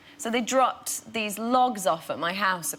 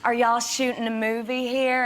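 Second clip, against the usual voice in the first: higher-pitched imitated Southern twang; Midwest Southern accent; 5 semitones higher, 3 dB louder than usual. Local asks actress about filming, Southern accent. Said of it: "sounds a little bit Midwest and Southern".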